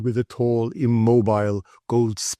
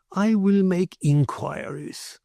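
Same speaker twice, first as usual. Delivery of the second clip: higher, slow, smooth merchant voice; slow and courteous, a little aloof; measured Spanish merchant; 9 semitones higher, slower than usual. Acted. Ramon answers Carlos.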